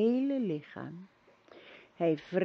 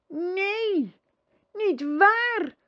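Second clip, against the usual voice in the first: put-on high, loud boy crying out; impetuous outcry; 12 semitones higher, 6 dB louder, much slower than usual. A narrator's reading. Boy protests to the empress, crying out high and loud. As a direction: burst out almost wildly in protest. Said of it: "riep Atreu haast onstuimig uit".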